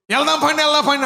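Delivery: an excited father hurrying the family to church; eager urging. Retold or imitated. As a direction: urge them eagerly: వెళ్దాం పండి, వెళ్దాం పండి. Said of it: "అంటున్నాడు".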